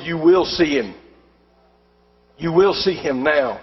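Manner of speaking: low, measured insistence; firm retold demand. Armed friend orders the doctor.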